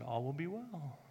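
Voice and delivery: the Spirit calming a frantic dog owner, hushed. Retold or imitated. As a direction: murmur it like a gentle presence. The Spirit repeats its words.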